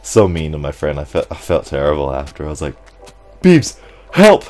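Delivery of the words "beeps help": high, loud mock panic; panicked cry. Player retelling his plea to his friend, panicked cry. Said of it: "I am panicking, I'm freaking out".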